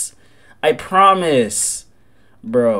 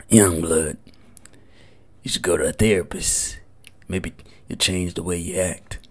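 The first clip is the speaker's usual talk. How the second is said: much lower, soft imitation of a character; whispering character; 11 semitones lower, 8 dB quieter than usual. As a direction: lowly repeat his advice. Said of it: "what he just whispered in my ear".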